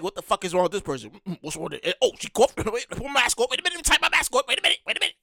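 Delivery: muttering disgusted under breath; hushed, suspicious side remark; quiet aside. Retold or imitated. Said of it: "one of my people looking at them like".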